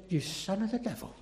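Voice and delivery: hushed quote. Retold or imitated. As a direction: say it softly first, almost under the breath. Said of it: "Very strong language".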